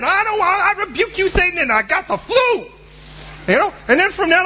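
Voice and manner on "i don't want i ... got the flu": high, hammed-up rebuking of Satan; mocking a churchgoer rebuking Satan; mock rebuke. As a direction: ham up the pious rebuke.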